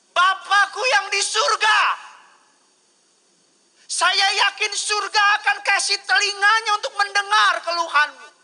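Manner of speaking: loud, high, driving sermon voice; full-force preaching. Acted. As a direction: preach it out at the top of your voice.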